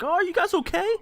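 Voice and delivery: high-pitched put-on voice of concern; mock concerned onlookers. Other couple asking after the tumble, mock concern. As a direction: check on them in a high mock voice.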